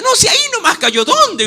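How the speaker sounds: high, quick puzzled protest; high-pitched mock disbelief; acted bewilderment. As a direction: play someone baffled at losing something. Acts out someone searching for it.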